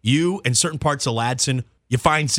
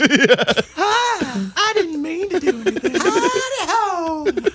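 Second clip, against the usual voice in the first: high cartoon falsetto; very high, silly little voice; Mister Hanky; much slower than usual. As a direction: squeak it out like a tiny cartoon character. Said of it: "It sounds like Mister Hanky almost".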